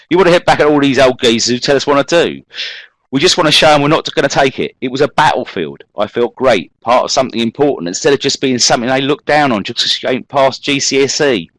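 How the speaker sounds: put-on London accent. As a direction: rattle it off like a defiant London teen. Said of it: "I'm imagining this, uh, that this is how this gentleman spoke".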